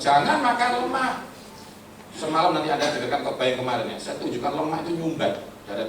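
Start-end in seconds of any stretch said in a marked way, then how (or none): 0.0-1.1 lightly voiced parent, pitched high; parent's warning. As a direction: warn like a parent, voice lifted high. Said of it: "Jadi kalau orang tua bilang". (tiruan orang tua melarang, nada tinggi)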